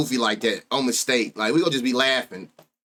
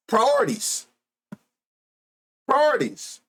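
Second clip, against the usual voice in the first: incredulous scolding; 11 semitones higher than usual. Acted. Scolding bettors who skip diapers.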